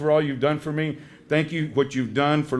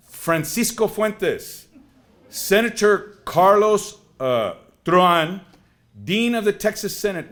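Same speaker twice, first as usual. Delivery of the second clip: formal senator voice; 6 semitones higher, 5 dB louder, slower than usual. Retold or imitated. Senator leaving a message.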